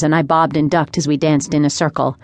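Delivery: usual speaking voice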